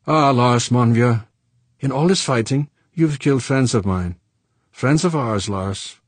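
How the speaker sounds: reproachful Frenchman. Acted. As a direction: reproach an old friend for killed friends. Saint Clair reproaching Fuchs.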